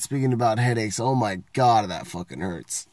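normal talking voice

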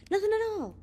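high, loud outburst; heated denial. A narrator's reading. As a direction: snap back defensively, voice jumping up. Evans snaps back in a quarrel.

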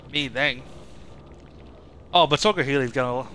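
normal talking voice

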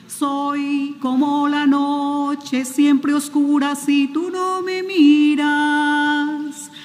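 normal talking voice

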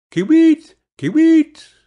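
high, loud, piping bird cry; bird call. A narrator's reading. Bird singing from the juniper tree, high, loud call. Bird's call within its song.

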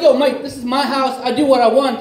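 hammed-up protest to a neighbour; mock indignation. Acts arguing back at the neighbour.

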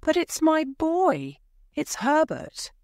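high, pleading insistence. A narrator's reading. Wife pleads with husband, high and pleading. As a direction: beg him to understand. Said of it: "she answered".

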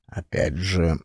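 ordinary delivery, nothing marked

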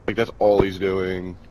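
ordinary delivery

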